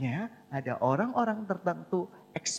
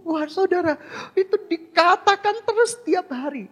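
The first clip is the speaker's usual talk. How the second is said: higher and louder, marvelling at the congregation; excited exclamation; 12 semitones higher, 8 dB louder than usual. Real, not acted. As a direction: call it out with wonder.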